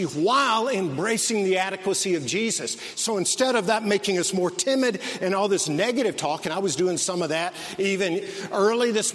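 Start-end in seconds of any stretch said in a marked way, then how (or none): none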